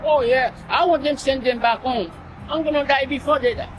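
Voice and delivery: ordinary talk, nothing marked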